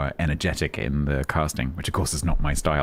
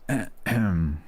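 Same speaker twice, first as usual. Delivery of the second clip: usual speaking voice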